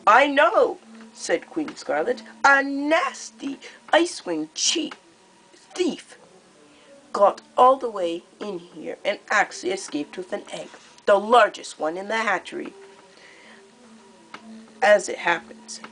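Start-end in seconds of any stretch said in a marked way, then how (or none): 0.0-0.8 high, louder, theatrically sweet menace; gleeful cruel queen. Acted. Queen Scarlet speaks. 2.5-12.7 scornful queen. Acted. Scarlet sneers at the thief.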